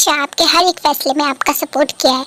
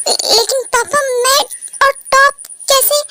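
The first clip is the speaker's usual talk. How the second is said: crying, voice jumping higher; breaking into tears as she objects; tearful protest; 7 semitones higher than usual. Acted. Daughter upset at father's condition. (रोते हुए, ऊँची टूटती आवाज़ में)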